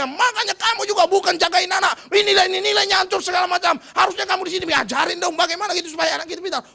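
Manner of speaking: loud, high furious rant at his wife; angry husband scolding. Retold or imitated. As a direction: blast the wife with blame. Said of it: "suaminya langsung marahin juga istrinya". (suami memarahi istri dengan keras)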